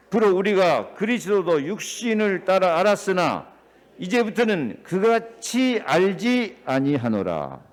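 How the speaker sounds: loud, preached scripture reading. Acted. Pastor reading the verse aloud to his church, proclaiming.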